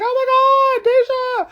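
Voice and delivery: put-on high, squealing girl's voice; starstruck squeal. Dancer spotting her choreographer, high excited squeal. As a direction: gush like a starstruck young fan. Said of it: "And she's like".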